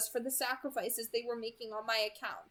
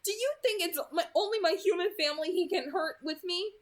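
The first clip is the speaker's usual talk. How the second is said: higher, louder, agitated character voice; upset protest; 7 semitones higher, 5 dB louder than usual. A narrator's reading. Worried girl argues about danger to her family, upset protest. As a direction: protest with rising urgency.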